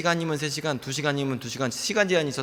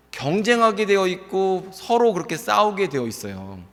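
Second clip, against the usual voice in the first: ordinary talk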